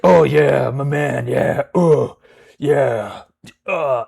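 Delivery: loud, hammed-up macho hype; mock he-man cheering with grunts; over-masculine guy. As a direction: blurt it like a pumped-up meathead. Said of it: "he, like, is over masculinized".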